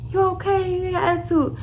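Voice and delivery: high-pitched prying check-in; imitated mom and auntie. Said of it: "my mom and my auntie came over there like".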